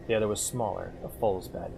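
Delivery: steady, low-key audiobook reading; calm first-person story reading; story narration. A narrator's reading. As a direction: read the tale evenly to the listener. Reads fantasy story aloud.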